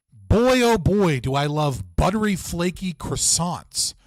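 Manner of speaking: loud over-the-top American, slowing at the end; mock gushing American tourist; playful American accent, drawn-out ending; hammed-up American accent. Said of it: "in an American accent".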